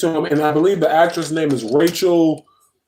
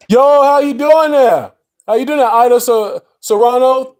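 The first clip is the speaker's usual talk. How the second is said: excited greeting; 5 semitones higher, 3 dB louder than usual. Real, not acted. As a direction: hype up the new viewer. Greets a new viewer on stream.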